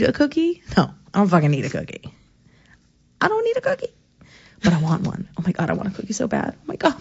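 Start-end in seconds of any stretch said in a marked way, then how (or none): 3.2-3.9 1 squeaky denial. Acted. Squeaky self-denial about cookie.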